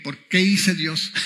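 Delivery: ordinary talk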